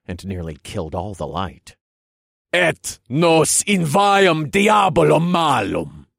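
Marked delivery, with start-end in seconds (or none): 2.5-6.1 loud, slow bellowed incantation; bellowing. A narrator's reading. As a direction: bellow the Latin to the heavens. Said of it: "I bellowed".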